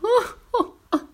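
high acted laugh; storybook peal of laughter; burst of laughter. A narrator's reading. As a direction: burst out in high-pitched hoots. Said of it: "un grand éclat de rire".

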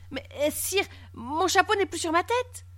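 high, hesitant, bewildered voice; timid puzzlement. A narrator's reading. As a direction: protest timidly to a king, voice rising. Frightened subject contradicts the king. (protestation timide, voix haut perchée)

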